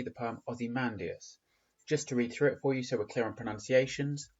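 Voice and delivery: ordinary talk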